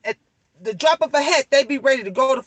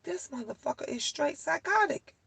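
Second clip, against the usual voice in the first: much softer, a touch higher, under the breath; quiet aside; 4 semitones higher, 11 dB quieter than usual. Retold or imitated. Recalling the tenant's threat, a much softer muttered aside. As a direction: mutter it to yourself in disbelief. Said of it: "I'm like".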